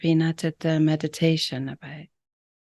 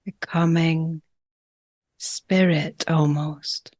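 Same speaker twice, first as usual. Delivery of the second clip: calm, unhurried breathwork guide with long pauses; gentle meditation leader, phrase by phrase; guided meditation. Real, not acted. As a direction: ease them into each breath, leaving lots of space.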